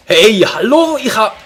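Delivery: loud furious yelling; angry shout. Real, not acted. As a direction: yell at the screen. Raging at the game.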